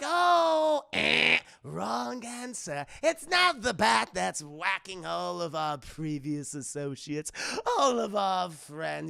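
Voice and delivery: loud game-show buzzer villain, then slow taunt; mocking, theatrical villain correction; Joker. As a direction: blare the start, then draw out the words.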